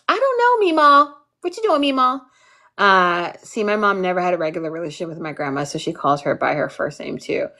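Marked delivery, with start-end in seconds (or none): 0.1-2.2 much higher, taunting sing-song; teasing. Retold or imitated. Kid teasing grandmother to annoy her, higher taunting. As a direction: needle her on purpose. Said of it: "I'd be like".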